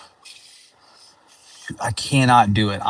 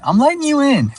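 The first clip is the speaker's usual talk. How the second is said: high, sing-song and louder; mock friendly; 4 dB louder than usual. Real, not acted. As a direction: sweetly wave the driver in, teasing. Letting a driver merge in.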